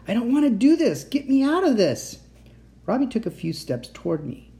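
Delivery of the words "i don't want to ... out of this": high, loud desperate protest; calling out. A narrator's reading. Captive child pleads with the boy, calling out. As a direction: shout for rescue, pitch climbing. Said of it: "I called".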